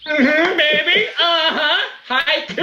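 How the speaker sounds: high, giddy victory crowing; gleeful gloating. Real, not acted. Player celebrating the haiku roll, high and gleeful. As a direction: crow over a lucky roll.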